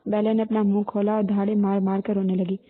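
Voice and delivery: usual speaking voice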